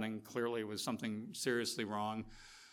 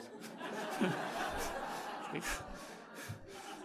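laughter; 6 semitones higher, 7 dB quieter than usual. Real, not acted. Laughing at lost keys.

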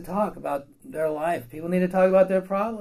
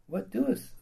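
softer, slow and hushed; a quiet, slow divine voice; still small voice; 5 semitones higher, 6 dB quieter than usual. Acted. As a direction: let God speak gently from the stillness. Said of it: "a voice of stillness".

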